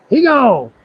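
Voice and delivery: normal talking voice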